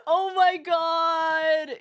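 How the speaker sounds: shocked exclaim. Real, not acted. Shocked reaction.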